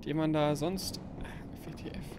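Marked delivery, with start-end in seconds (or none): 1.2-2.0 whispered disbelief; whispering. Real, not acted. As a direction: breathe it out in a whisper. Surprised by big enemy.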